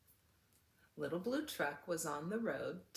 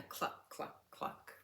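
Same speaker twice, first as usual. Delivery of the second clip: whispered hen clucking; whispered clucks; 7 dB quieter, slower than usual. Acted. The hen clucks softly as everyone squeezes in.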